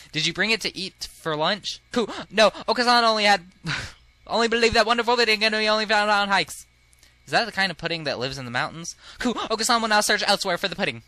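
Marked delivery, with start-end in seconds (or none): 1.9-6.7 loud, high, rapid-fire bird character; high squawky pudding fanatic; annoying bird voice. Acted. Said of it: "I don't know why I gave him that voice". 9.2-11.0 high squawky pudding fanatic; annoying bird voice. Acted. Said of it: "I don't know why I gave him that voice".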